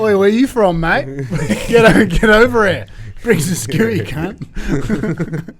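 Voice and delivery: put-on loud, high Australian accent with laughs; mock Aussie voice. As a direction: play a rowdy Australian bloke in a heavy Australian accent. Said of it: "imagine me like the outback in Australian prison".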